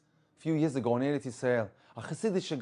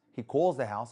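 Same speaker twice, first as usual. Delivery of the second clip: ordinary talk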